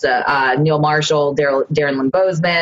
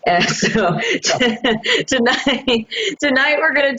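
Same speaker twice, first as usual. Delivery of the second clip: laughing; 7 semitones higher, slower than usual. Real, not acted. Laughing while restarting intro.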